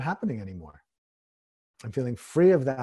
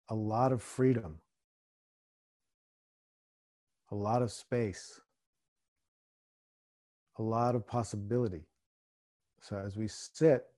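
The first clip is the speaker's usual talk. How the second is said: guided meditation; 3 semitones lower, 4 dB quieter than usual. Acted. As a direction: ease them into stillness. Meditation guidance.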